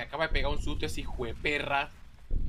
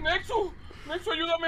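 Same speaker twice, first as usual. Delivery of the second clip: high panicked calling; panicked call for help; 12 semitones higher, slower than usual. Real, not acted. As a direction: call your friend, scared.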